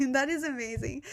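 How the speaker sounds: ordinary talk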